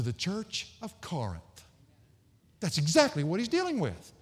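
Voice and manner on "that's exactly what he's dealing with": ordinary talk, nothing marked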